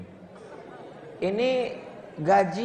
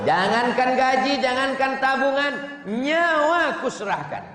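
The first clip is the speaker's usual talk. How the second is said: higher and louder, swelling promise; passionate vow; 7 semitones higher, 4 dB louder than usual. Acted. Husband vowing his life to wife, passionately louder. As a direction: declare it like a hero's oath.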